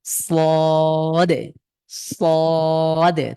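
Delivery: সদে held very long, tongue behind front teeth; stretched sad sound. Shown as a demonstration. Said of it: "জিভটাকে সামনের দাঁতের পাটির সাথে".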